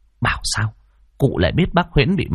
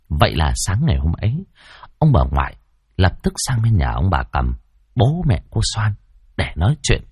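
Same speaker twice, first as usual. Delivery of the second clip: ordinary delivery, nothing marked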